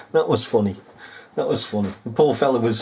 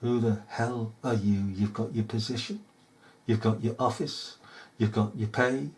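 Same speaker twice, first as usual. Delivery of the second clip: low, hushed challenge to the officials; quiet confrontation; 6 semitones lower, 11 dB quieter than usual. Acted. Tells police chiefs straight he's unafraid, quiet confrontation. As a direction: take them to task softly, point by point.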